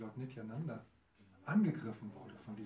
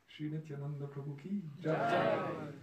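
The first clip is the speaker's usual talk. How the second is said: devotional call; 5 semitones higher, 8 dB louder, slower than usual. Acted. Storyteller leads a devotional call.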